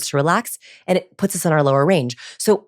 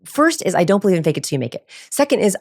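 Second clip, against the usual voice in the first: ordinary talk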